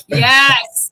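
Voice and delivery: loud, emphatic acted agreement; eager yes. Answering the running headlock joke, eager yes. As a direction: belt out a gung-ho acceptance.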